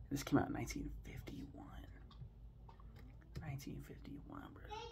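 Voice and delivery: breathy disbelieving whisper of the year; stunned whisper. Real, not acted. Whispering to the viewers in disbelief at the film's age. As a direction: whisper it as if you can't believe it.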